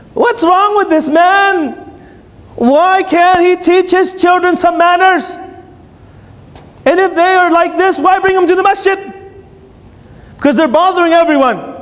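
indignant thought. Retold or imitated. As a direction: huff with outrage at the careless man. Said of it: "And you're thinking".

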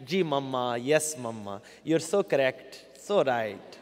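put-on low, soft, deferential Desi accent. Kids agreeing with mama in Desi accent. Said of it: "speak English in a Desi accent".

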